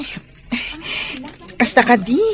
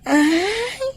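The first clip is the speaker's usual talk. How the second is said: crying plea; 6 semitones higher than usual. Acted. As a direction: voice breaking into sobs, pitched up. Tearful plea to her lover.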